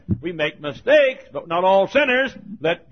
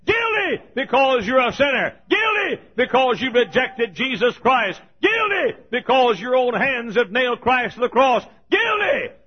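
full-force preaching; 8 semitones higher than usual. Real, not acted. Preacher shouting guilt at the congregation, full force. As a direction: hammer each guilty home.

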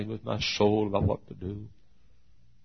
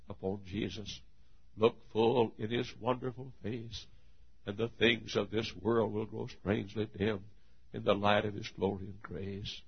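hushed preaching; 12 dB quieter than usual. Acted. Preacher urging the congregation to look to Jesus, hushed. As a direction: pull them in close and quiet.

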